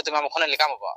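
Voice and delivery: ordinary talk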